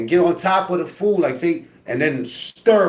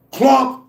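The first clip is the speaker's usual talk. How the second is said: loud clunk noise; 11 semitones higher, 6 dB louder than usual. Retold or imitated. Sound of ball hitting head.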